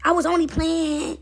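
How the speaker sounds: mock whiny excuse; backpedaling takers. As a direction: stretch it out, feigning innocence. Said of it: "They like".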